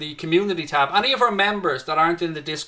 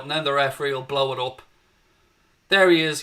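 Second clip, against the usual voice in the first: ordinary delivery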